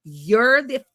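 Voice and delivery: normal talking voice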